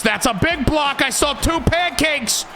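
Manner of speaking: high, shouted call of the run; excited play-by-play. Real, not acted. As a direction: call the big block like a hyped announcer.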